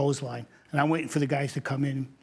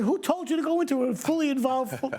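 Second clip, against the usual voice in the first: incredulous scolding; 11 semitones higher than usual. Retold or imitated. Captain scolding firefighter.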